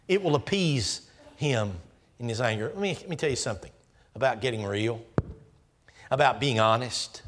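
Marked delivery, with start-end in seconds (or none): none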